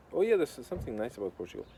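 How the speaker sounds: ordinary talk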